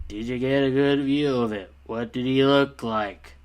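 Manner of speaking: bored, apathetic monotone in mock imitation; Toby. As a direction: read it flatline, as if nothing matters. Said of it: "the boredom of Toby".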